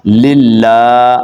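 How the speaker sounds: slow tajweed model of للہ; elongated lam. Shown as a demonstration. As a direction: chant للہ with a long held "laa". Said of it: "لام کو آپ نے لمبا کرنا ہے".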